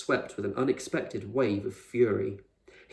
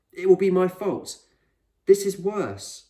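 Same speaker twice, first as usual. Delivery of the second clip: higher, louder, slowing anguished confession; a kid terrified of being to blame; frightened boy; 9 semitones higher, 7 dB louder than usual. Acted. Boy confesses fear of failing.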